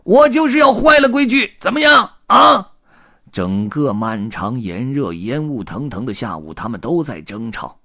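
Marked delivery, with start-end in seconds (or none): 0.0-2.7 very high, very loud challenge; furious tramp's full-voiced yell; defiant roar. A narrator's reading. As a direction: scream back, daring them to object. Red beard defies the others, quarrel.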